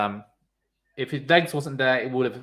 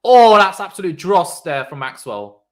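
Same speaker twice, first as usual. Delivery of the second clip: much louder, outraged call; loud exclamation; 4 semitones higher, 12 dB louder than usual. Real, not acted. Streamer slamming Maxwell's bowling with a loud outcry. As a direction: blast the bowler's poor delivery. Reacting to a bad ball.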